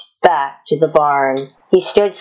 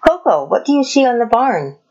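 higher and quick, a teasing quiz; Cowgirl Kate's voice; 8 semitones higher, faster than usual. A narrator's reading. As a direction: lead the horse to the answer brightly. Kate quizzing her horse.

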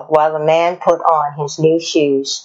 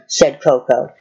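ordinary delivery, nothing marked

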